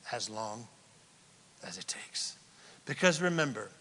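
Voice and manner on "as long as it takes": very soft, low, measured answer; hushed. Real, not acted. Preacher repeats the answer on true repentance, hushed. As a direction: drop right down, pausing midway. Calm retake after the shout.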